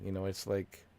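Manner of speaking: normal talking voice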